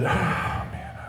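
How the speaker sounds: soft, airy sigh of dismay; troubled sigh. Retold or imitated. Worker asked what's wrong by manager, troubled sigh. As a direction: re-enact a heavy, worried exhale.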